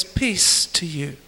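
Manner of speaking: low, soft, slow blessing; slow tender voice of Jesus; calm peace. Retold or imitated. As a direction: speak peace gently, like Jesus calming them. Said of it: "He says".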